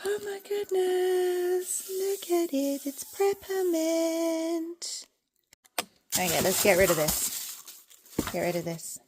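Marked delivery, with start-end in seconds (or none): 0.1-5.0 soft, high, slow squeal of awe; excited. Real, not acted. Unboxing Preppermint for viewers, hushed excitement. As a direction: draw it out high and hushed, thrilled. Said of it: "I'm so excited".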